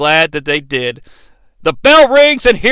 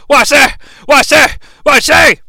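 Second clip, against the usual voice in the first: short, high, loud bursts; imitated punch grunts; 7 semitones higher, 5 dB louder than usual. Mimicking Mankind punching in the corner, loud staccato yelps. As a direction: punch out each burst like a blow.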